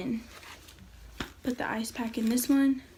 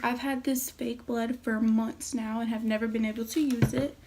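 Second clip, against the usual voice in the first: ordinary talk, nothing marked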